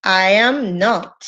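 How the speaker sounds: each word slow and separate; slow and spelled out. Shown as a demonstration. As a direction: lay out I am not slowly for learners. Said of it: "tú me tienes que decir". (respuesta lenta y separada)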